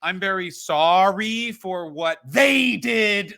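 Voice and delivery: mock apology that swells into loud blame; Joey Ryan. Wrestler's apology video shifting blame, loud and drawn out. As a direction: drag it out, hitting "they did" hard. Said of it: "where he kept being like".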